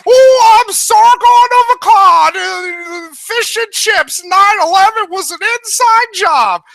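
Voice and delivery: high, slow mock British accent; drawn-out, high put-on English accent, played for laughs; bad Sargon impression. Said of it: "it's my Sargon impression".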